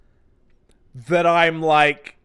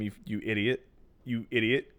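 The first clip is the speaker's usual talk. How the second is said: soft, lower, deadpan insult; muted mock scorn; 5 semitones lower, 7 dB quieter than usual. Player scolding the fooled Sam, quiet mock scorn. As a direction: lightly mutter it in disbelief.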